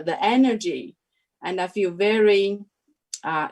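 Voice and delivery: usual speaking voice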